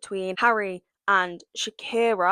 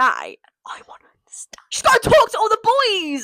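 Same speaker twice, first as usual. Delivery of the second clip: urgent whisper; 12 semitones higher, 5 dB louder than usual. Acted. Whispered plea for [name].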